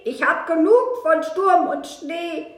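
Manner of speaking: loud, complaining animal character with a touch of growl; grumbling fox. A narrator's reading. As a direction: grumble as a worn-out, freezing fox. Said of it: "Der knurrt".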